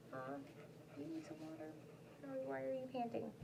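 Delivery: quiet doting voice to a dog; soft pet talk. Real, not acted. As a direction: gently ask the dog.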